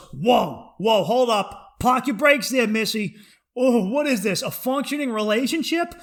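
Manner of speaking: loud, high, sarcastic halt; mock inner alarm. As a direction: stop her loudly, playing scandalized. Acts inner voice halting relationship.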